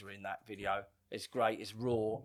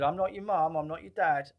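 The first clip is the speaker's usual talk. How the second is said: higher, louder angry scolding; mock angry telling-off; 6 semitones higher, 6 dB louder than usual. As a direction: put the kid firmly in his place. Adult losing patience with kid.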